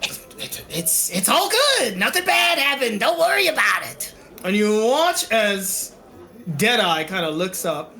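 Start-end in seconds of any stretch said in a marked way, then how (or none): 0.0-4.2 high, anxious little pleader. Acted. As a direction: plead in a small, raised voice. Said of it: "like, a little voice go".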